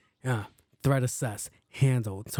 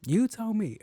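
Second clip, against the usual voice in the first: much higher, insistent; high challenge; 8 semitones higher, slower than usual. Shown as a demonstration. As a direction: throw "You tell me" up high. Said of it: "repeated challenge".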